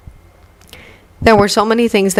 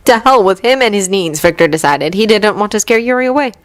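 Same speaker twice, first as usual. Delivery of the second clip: ordinary delivery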